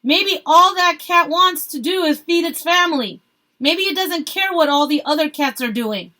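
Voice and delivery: man heckling the protesters; called out. A narrator's reading. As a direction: shout back at the speaker. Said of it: "A man called out".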